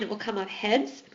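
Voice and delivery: usual speaking voice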